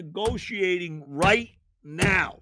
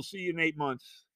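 ordinary talk, nothing marked

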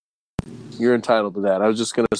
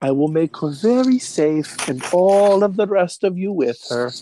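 higher-pitched, hammed-up German villain; horrible German accent; 9 semitones higher than usual. Villain holding Granny hostage, in a horrible German accent. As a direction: threaten in a bad German accent. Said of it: "I do a horrible German accent".

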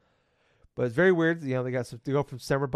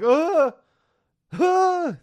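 high, loud cartoon cries of pain; Stewie pain cries; 9 dB louder than usual. Imitating a sunburnt cartoon baby getting out of the tanning booth, yelping. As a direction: yelp like every move hurts. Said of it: "I'd be like Stewie".

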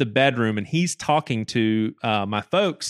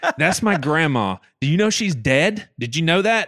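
raised, indignant, half-laughing challenge; retold angry outburst; 5 semitones higher, 3 dB louder than usual. Grandson confronting the police officer over his dead grandma, indignant and raised. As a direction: fire questions at him, higher and louder, laughing. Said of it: "I must have sounded so stupid 'cause I was so angry".

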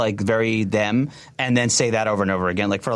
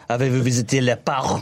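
French phrase. Retold or imitated. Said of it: "I was learning French".

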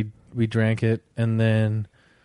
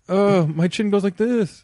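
amazed weirdo voice; 7 semitones higher than usual. Pretend.

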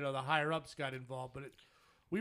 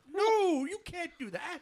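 high-pitched outburst, loud on the first word; alarmed protest; 12 semitones higher, 6 dB louder, slower than usual. Retold or imitated. Customer protesting a suspended account, alarmed. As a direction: cry out in disbelief.

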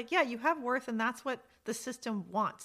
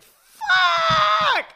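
sudden high yelled curse; mock panicked shout; 14 dB louder than usual. System bosses realizing people quit buying, shouting. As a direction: blurt it out like a panicked boss. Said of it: "they'd be like".

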